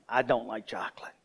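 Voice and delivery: hushed confession; a guilty whispered aside. Shown as a demonstration. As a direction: whisper it like a secret.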